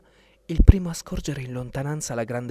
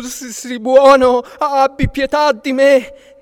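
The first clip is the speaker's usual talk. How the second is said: high, loud, slow begging for mercy; frightened pleading; 11 semitones higher, 12 dB louder, slower than usual. Acted. Fleeing giant begs Papageno for mercy, high and loud. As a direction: wail it out, begging to be spared. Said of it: "implorò l'omone".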